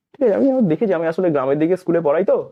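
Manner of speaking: ordinary delivery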